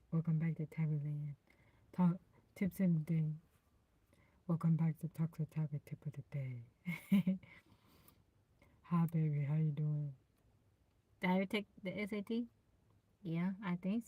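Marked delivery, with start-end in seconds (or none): 0.1-6.6 put-on deep host voice; a deepened show welcome. Shown as a demonstration. Streamer welcomes viewers back on request, deep voice. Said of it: "in your deep voice". 8.9-10.1 low, put-on deep hello. Shown as a demonstration. Streamer greets a viewer in deep voice. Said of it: "in your deep voice".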